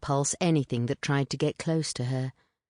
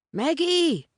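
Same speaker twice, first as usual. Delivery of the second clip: higher, louder call across the yard; 13 semitones higher, 4 dB louder than usual. A narrator's reading. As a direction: shout her name to the house. Calling into the dark.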